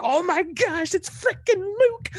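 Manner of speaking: high-pitched, loud fan freak-out; excited yelling. Retold or imitated. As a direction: re-enact losing your mind with joy, voice shooting way up. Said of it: "I was, like, yelling out".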